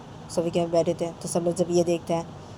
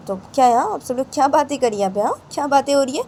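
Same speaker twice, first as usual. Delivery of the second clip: loud curious question; 8 semitones higher, 7 dB louder than usual. Acted. Aunt asking what's going on.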